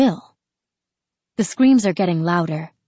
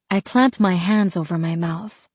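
plain reading voice

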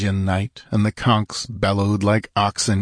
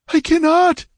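very high, loud anguished wail; 5 dB louder than usual. Acted. Yasmina refuses to kill her brother, wailing high and loud. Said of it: "she wailed".

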